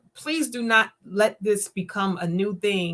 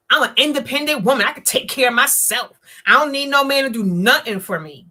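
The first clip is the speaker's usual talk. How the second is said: louder, brisk declaration of independence; defiant; 6 dB louder, faster than usual. Retold or imitated. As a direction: throw it out fast and proud. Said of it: "When you say that, that's a trigger".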